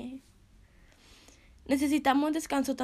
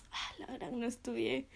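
quiet confession; 8 dB quieter than usual. Retold or imitated. Confesión a sí misma.

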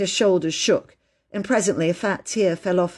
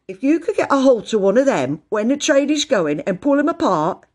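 higher-pitched, rustic working man; chatty porter; 5 semitones higher, 4 dB louder than usual. A narrator's reading. Porter telling the children about trains, chatty. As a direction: play a porter explaining things with relish.

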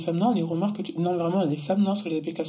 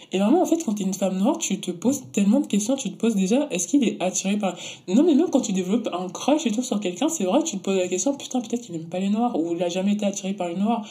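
usual speaking voice

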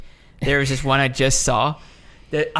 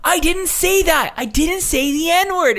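high, slow shrill-free mocking whine; mock Karen denial; 4 dB louder, slower than usual. Woman mocking a customer denying the slur, high whine. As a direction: play her protesting innocence, hammed up. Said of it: "She's like".

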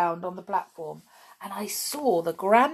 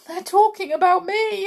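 high, louder alarmed thought; boy's scared thought; 12 semitones higher, 5 dB louder than usual. A narrator's reading. Boy hiding, alarmed thought. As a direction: realise with a jolt.

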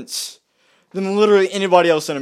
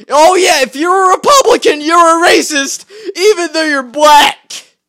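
much higher, loud sarcastic parody; mocking quote; 10 semitones higher, 10 dB louder than usual. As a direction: sneer it like a smug know-it-all. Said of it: "you are this dumb to go".

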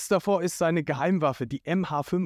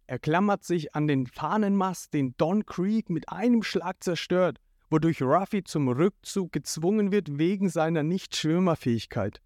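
usual speaking voice

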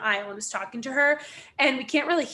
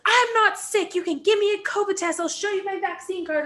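high-pitched indignant protest; heated self-defence; 9 semitones higher, 3 dB louder than usual. Retold or imitated. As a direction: imitate a passenger angrily insisting. Retold passenger defending herself angrily.

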